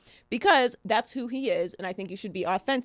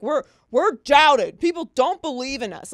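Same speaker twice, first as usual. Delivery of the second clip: mock mantra; 5 semitones higher, 7 dB louder than usual. Host mimicking the Patriots' yearly mantra, mock-aggrieved. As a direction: imitate players insisting they are doubted, louder. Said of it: "they channel it as this mantra for the year".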